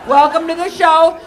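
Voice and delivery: usual speaking voice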